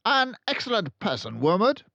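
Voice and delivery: loud, high, booming approval; Trunchbull barking. A narrator's reading. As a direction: bark out her praise of the car dealer. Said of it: "She either barked or shouted".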